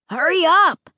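high, loud call from nearby. Acted. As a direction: call to her from close by. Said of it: "Luke called from somewhere nearby".

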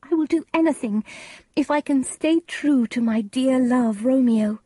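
high, urgent devotion; high-pitched, fervent young bride; Juliet. A narrator's reading. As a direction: promise desperately in a high voice. Juliet agrees eagerly.

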